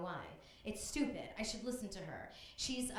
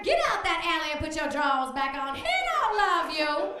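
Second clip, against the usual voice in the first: loud, higher stern bus driver; bus driver scolding; 6 semitones higher, 10 dB louder than usual. Retold or imitated. Middle-aged bus driver scolding young Sharon, loud and stern. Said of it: "saying things like".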